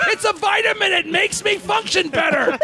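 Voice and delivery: high, hammed-up indignant husband; defensive yell. Husband defends his habit to wife, yelling high. Husband yells back in quarrel.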